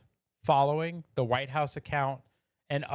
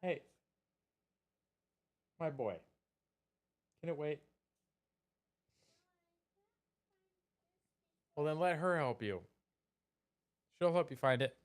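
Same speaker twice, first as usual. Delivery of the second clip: quiet aside; 3 semitones higher, 7 dB quieter than usual. Real, not acted. Aside to son, mic away.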